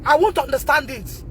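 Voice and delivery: ordinary talk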